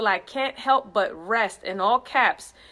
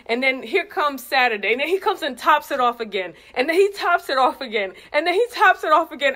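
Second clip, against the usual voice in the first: building excitement; 4 semitones higher, 5 dB louder than usual. Real, not acted. Building praise, tops it off.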